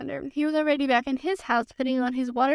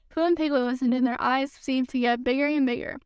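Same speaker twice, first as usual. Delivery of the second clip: usual speaking voice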